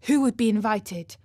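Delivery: plain reading voice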